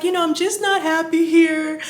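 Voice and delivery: retold weepy confession; imitated crying. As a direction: whine it as if crying to someone. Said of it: "I'm crying, and I was like".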